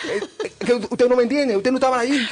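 put-on Cuban accent. Guide defending himself to the hosts, rising Cuban-accent rant. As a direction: ham up a flustered Cuban guide pleading to be understood, Cuban accent. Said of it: "no supera el acento cubano".

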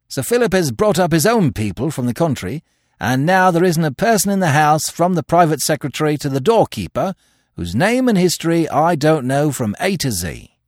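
high, forceful, brisk official; reporting police superintendent. A narrator's reading.

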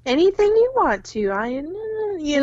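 meek eager-to-please wife; submissive and demure. Retold or imitated. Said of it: "she becomes very submissive and demure".

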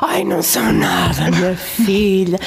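mock-distraught grandmother wail; imitated dramatic lament. Grandmother refusing to believe her granddaughter, mock dramatic lament. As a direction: lament it like an overdramatic, tearful grandmother. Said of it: "Aquilo era um drama para a minha avó".

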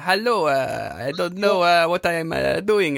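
comic Italian accent, vowels stuck on the word ends; mock Italian accent. Shown as a demonstration. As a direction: add an A to every word, Italian-style. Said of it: "don't you just add an A".

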